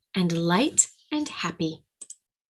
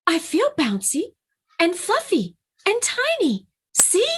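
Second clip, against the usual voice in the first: climbing higher and higher, eager; eager gasping plea; 11 semitones higher, 3 dB louder than usual. Acted. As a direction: prove it, rising with excitement. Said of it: "Bunny Bear gasped".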